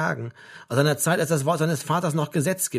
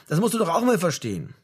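higher, mildly desperate appeal; a bit desperate; 6 semitones higher, 3 dB louder than usual. A narrator's reading. Mother asking her son to understand, a bit desperate. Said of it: "es klang ein bisschen verzweifelt".

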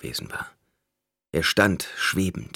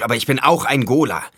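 raised, forceful insistence; heated protest; 6 semitones higher, 6 dB louder than usual. A narrator's reading. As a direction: protest loudly, pitch climbing. Protests his nature in argument. (lauter, heftiger Einwand)

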